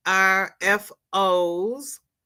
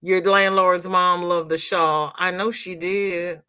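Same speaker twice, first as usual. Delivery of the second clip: ordinary talk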